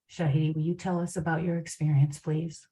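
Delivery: normal talking voice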